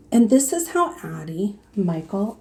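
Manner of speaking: usual speaking voice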